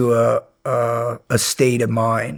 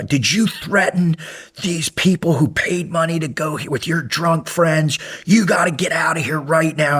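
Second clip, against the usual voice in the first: higher, scolding that builds to a shout; angry scolding; 6 semitones higher than usual. Retold or imitated. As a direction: scold him like an upset organizer catching a troublemaker. Woman scolds him at event.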